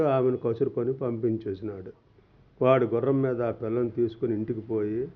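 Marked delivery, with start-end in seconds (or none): none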